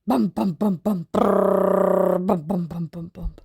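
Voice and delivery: low bum bum bums, fading away; low, fading landing bumps; bumpy landing noises. Acted. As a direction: bounce the words like wheels on a runway. Plane landing, bumping down.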